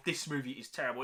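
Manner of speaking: ordinary delivery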